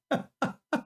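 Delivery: quiet giggle trailing off; amused disbelief; soft high laugh. Real, not acted. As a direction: chuckle to yourself. Laughing after rhetorical question.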